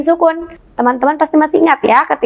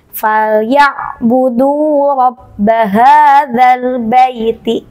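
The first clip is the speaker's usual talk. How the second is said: Quran recitation; much slower than usual. Shown as a demonstration. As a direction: recite each syllable slowly and clearly. Teacher recites the example verse. (lantunan bacaan Quran yang lambat dan keras)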